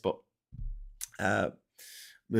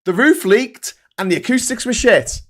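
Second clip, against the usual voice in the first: ranting reply; 7 semitones higher, 7 dB louder than usual. Retold or imitated. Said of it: "I'm like".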